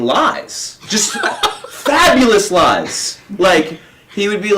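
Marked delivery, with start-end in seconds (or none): none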